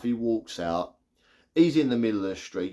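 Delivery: ordinary talk, nothing marked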